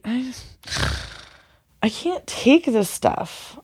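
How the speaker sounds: holding back tears; emotional, choked up. Real, not acted. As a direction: voice tightening with emotion. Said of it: "I'm too emotional".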